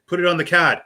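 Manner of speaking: "card" without its r, as "cahd"; retold line, r dropped in "card"; New England "card". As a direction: repeat it with Boston "card". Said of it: "how much of an accent you have".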